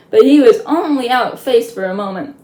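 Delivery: ordinary delivery, nothing marked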